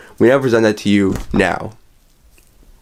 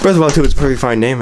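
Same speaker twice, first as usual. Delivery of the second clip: rushed; 2 semitones higher, much faster than usual. Pretend. Rushed correction after boss yells.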